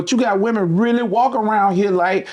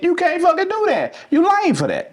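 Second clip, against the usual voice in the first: heated; 13 semitones higher than usual. Real, not acted. Heated rant peak.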